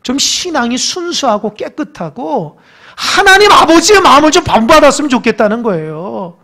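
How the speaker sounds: fervent preaching. Acted. Pastor rebuking unbelief to the congregation, loud. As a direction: rise up and rebuke.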